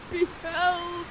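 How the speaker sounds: high, slow, hammed-up mock sobbing; melodramatic prince. Ferdinand mourning his father, in a hammed-up high wail. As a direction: overact the grieving prince.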